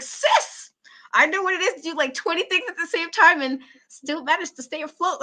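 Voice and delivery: high, loud admiring cheer; gushing over the wrestler; admiring sis. Retold or imitated. Fan praising the med student wrestler, high admiring gush. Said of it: "I was like".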